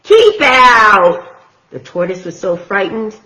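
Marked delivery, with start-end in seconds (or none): 0.0-1.3 loud, cross warning; grumpy yell. Acted. Hank yells at the tortoise to keep out, loud. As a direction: bark it at the intruder. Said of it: "yelled Hank".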